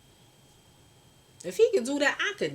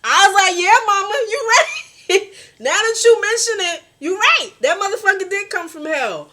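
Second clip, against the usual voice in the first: much higher, louder, laughing through it; laughing agreement; 13 semitones higher, 11 dB louder than usual. Real, not acted. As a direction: crack up agreeing with mama.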